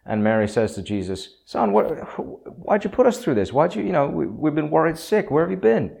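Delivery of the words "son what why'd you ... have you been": much higher, anxious mother's voice, words tumbling out; a fretful mother who finally found her boy; scolding mother. Acted. Mary scolding Jesus after searching Jerusalem, high worried mother.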